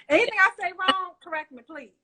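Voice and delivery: usual speaking voice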